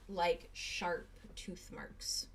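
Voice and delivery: slow, hushed horror reveal; ominous whisper. A narrator's reading. Narrator revealing the bite to Arlo, slow ominous whisper. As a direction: draw out the dread.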